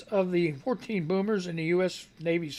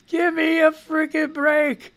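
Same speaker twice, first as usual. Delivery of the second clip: usual speaking voice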